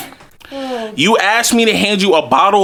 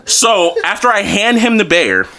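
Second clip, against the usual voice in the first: ordinary talk, nothing marked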